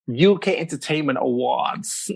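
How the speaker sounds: put-on American accent after a laugh; British speaker imitating American speech. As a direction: do your best American accent, amused. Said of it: "in your best American accent".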